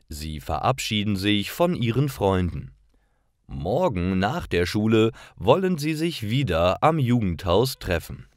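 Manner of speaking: normal talking voice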